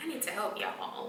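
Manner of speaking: whispered mock disbelief. Repeating the nurses' demand in a whisper. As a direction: hiss it under breath, mocking. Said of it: "mocking whisper".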